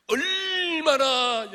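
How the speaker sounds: "얼마나" stretched long, high and loud; drawn-out emphasis. Real, not acted. Preacher stressing how loud the shouting was, "얼마나" drawn out loud. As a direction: belt out "얼마나", holding the vowel.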